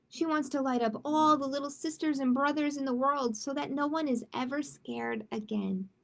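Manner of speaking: plain reading voice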